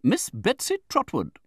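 high, slow, self-important announcement; Betsy Trotwood. A narrator's reading. Aunt introduces herself to the widow, imperious. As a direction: introduce yourself grandly, high and drawn out.

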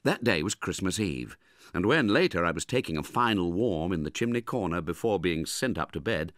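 plain reading voice

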